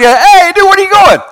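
high, loud buddy call; calling after. Acted. Healed lepers call after the one turning back, loudly. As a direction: shout after a friend walking off.

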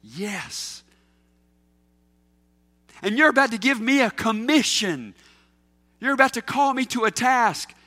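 a preacher playing both voices, lightly acted; voiced dialogue with Jesus. Preacher acting out Jesus calling him to a task, lightly voiced. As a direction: trade lines with Jesus, his voice steady and sure.

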